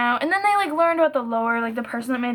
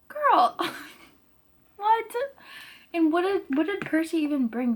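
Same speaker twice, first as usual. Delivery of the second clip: surprised; 5 semitones higher than usual. Real, not acted. Reacting to Hera leaving.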